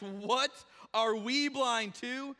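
loud, slow, offended disbelief; indignant Pharisees. Retold or imitated. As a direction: demand it back as if insulted. Offended Pharisees questioning Jesus.

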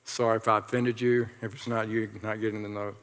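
low, soft offhand apology; muttered aside. Real, not acted. Preacher apologizing to those not getting the note, muttered aside. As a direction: drop low and quiet, as an aside.

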